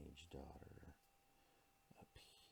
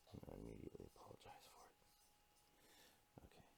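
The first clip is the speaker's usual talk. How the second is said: whispered aside; 7 semitones lower, 8 dB quieter, much faster than usual. Real, not acted. Sheepish aside after silly accent.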